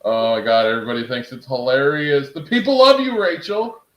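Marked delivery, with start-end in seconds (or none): 2.3-3.8 high, loud teasing call to the wife; mock-adoring shout to Rachel; excited. Real, not acted. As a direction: sing it out to her, delighted.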